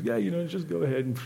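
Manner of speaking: lightly voiced casual cop. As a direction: wave him on like a bored cop. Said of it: "goes back over to another guy and goes".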